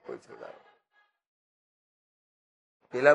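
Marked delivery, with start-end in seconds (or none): 0.1-0.6 voiceless, much softer, trailing off; whispered plea. Acted. Bound man's plea fading into a whisper. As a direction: breathe the plea out as it dies.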